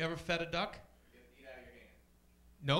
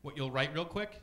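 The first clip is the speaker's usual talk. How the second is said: normal talking voice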